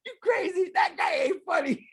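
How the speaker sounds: high, loud mock outburst; retold shout. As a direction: yell it like an offended comic. Quoting Cho shouting, retold.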